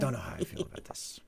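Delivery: fast breathy whisper. Shown as a demonstration. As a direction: whisper it quickly. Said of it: "repeated as whisper".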